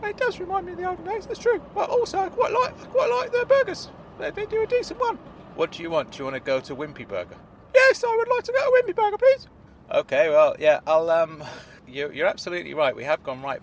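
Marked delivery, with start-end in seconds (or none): 0.0-5.2 high, fast squeaky chatter; high-pitched old uncle voice; high Claude voice. Acted. Claude explaining fondness. 7.7-9.5 high, fast and loud squeaky request; high-pitched eager character voice; polite Claude. Acted.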